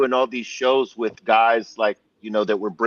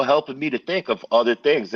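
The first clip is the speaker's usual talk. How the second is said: usual speaking voice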